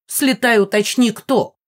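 emphatic, sarcastic bidding; taunting order. A narrator's reading. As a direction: send her off with a mocking command. Mama taunts the ghost, quarrel.

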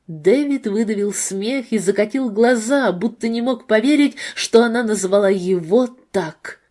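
ordinary delivery, nothing marked

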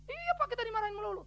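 high-pitched mock employee complaint; complaining employee. Pegawai mengeluh soal bos. (keluhan pegawai yang ditirukan, bernada tinggi)

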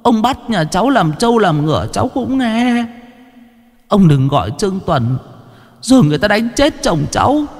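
high, tearful begging voice; a frightened wife pleading for her dying husband; pleading peasant woman. A narrator's reading. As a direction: beg the old man for shelter, pitched high. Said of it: "nghe tiếng van".

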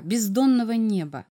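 usual speaking voice

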